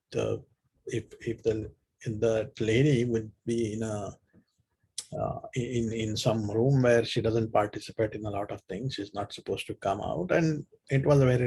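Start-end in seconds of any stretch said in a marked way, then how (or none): none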